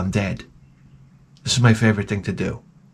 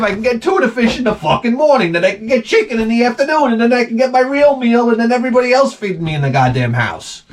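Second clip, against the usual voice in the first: giddy dog voice; 10 semitones higher than usual. Retold or imitated. Comic's dog celebrating that its owner is alive, high and giddy. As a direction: cheer like a dog whose meal ticket survived. Dog's overjoyed inner voice.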